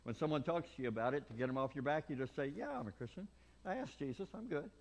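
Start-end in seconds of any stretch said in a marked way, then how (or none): 2.6-4.7 high, offhand brush-off; dismissive brush-off. Retold or imitated. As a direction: shrug someone off. Mocking a casual claim.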